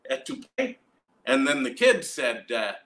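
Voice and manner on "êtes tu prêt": eager French question. Retold or imitated. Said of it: "and I said".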